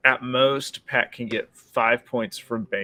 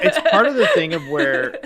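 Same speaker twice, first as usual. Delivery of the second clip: laughing; 10 semitones higher, 9 dB louder than usual. Real, not acted. Laughing after joke.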